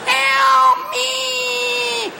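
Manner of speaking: very high, thin squeal; tiny trapped fly. Acted. Drunk lying in bed like The Fly, squealing for help. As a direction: squeak the plea in a long, tiny voice. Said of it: "like the scene from the movie The Fly".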